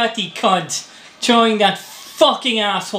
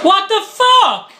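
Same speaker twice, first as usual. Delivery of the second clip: angry shout; 9 semitones higher, 5 dB louder than usual. Real, not acted. Gamer raging at the game.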